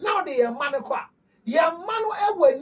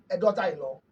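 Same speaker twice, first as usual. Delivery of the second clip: ordinary talk, nothing marked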